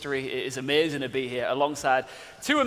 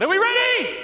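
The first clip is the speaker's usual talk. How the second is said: high excited shout, much higher and louder; rally the room, pitched way up; hype shout; 5 dB louder than usual. Real, not acted. Host hypes crowd before start.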